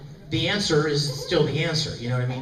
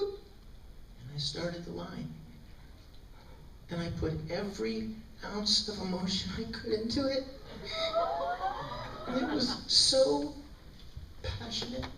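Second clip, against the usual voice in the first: hushed intensity; 5 semitones higher, 10 dB quieter than usual. Retold or imitated. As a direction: savour the big moment in a hush. Hushed retelling of an emotional take.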